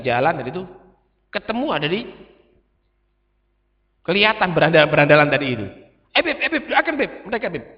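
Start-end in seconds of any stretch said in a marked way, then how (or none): 6.2-7.6 higher, urgent, excited call; eager calling out. Retold or imitated. Follower urgently begging the Habib to pray, excited. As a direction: tug at the Habib's sleeve with your voice.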